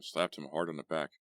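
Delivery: ordinary delivery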